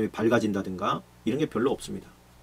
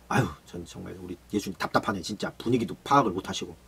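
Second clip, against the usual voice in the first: lower, softer inner complaining; muttered grumble; 6 semitones lower, 6 dB quieter than usual. Acted. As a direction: grumble under your breath about Jesus. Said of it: "이런 생각을 했을 거예요". (분위기 파악 못하는 예수님을 속으로 낮게 원망하는 제자)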